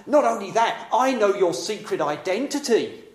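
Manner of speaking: high, loud, sneering demon voice; mocking intimidating spirit. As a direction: taunt him, you know his secret. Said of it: "The spirit is trying to intimidate Jesus".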